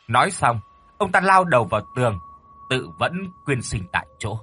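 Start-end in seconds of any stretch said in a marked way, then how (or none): none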